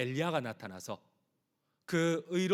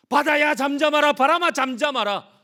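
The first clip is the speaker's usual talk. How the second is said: much higher, loud, ringing command; Jesus rebuking the storm, loud commanding cry; commanding shout; 13 semitones higher, 16 dB louder than usual. Retold or imitated. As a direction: command the sea and wind with full authority. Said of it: "천하 만물을 호령하셨던".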